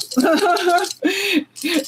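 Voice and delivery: high-pitched giggling burst; burst of laughter. Real, not acted. Streamer cracking up after ranting about a show, high giggle. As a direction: crack up at your own rant. Laughs at her own rant.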